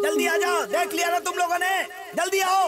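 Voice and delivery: father calls the friends in, high and urgent. Acted. Father urging them inside from ghost.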